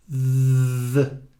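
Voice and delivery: a lone voiced "Th" with the tongue between the teeth; isolated th sound. Shown as a demonstration. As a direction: hold the tongue out and voice it. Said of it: "coloca a língua pra fora, entre os dentes".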